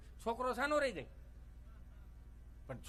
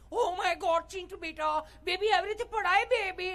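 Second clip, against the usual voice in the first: much higher, louder panicked mother; fussy educated mom; 8 semitones higher, 7 dB louder, slower than usual. Retold or imitated. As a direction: overreact like a posh mummy. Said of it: "mummy બિચારી P H D કરેલી". (ભણેલી મમ્મીનો ઊંચો ગભરાયેલો અવાજ)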